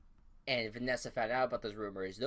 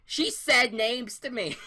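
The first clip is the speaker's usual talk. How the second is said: mock shout; 12 semitones higher, 10 dB louder, slower than usual. Meg confronting Vanessa, acted.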